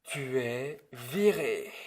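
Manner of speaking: loud, slow, final verdict; slow firm firing. Acted. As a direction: lay down the sentence word by word. Director firing the set designer.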